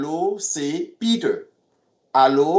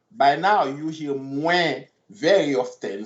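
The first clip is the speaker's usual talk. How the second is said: usual speaking voice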